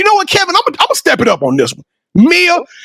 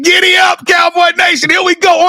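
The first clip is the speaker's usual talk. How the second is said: hype call; 6 semitones higher than usual. Real, not acted. Host rallying Cowboy Nation: hyped-up count to three. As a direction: pump up the crowd on the count. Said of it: "On the count of three".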